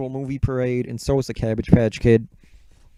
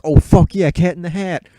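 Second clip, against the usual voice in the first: hyped fan; 5 semitones higher, 8 dB louder than usual. Retold or imitated. Fans hyped for Cat in the Hat.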